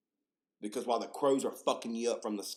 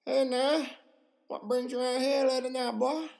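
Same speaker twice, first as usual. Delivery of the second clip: high, loud smiling Southern officer; creepily cheery Southern lawman, pitched up; creepy Southern cop; 4 dB louder than usual. Retold or imitated. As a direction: sing out like an overfriendly small-town cop. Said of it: "they're just smiling and just like".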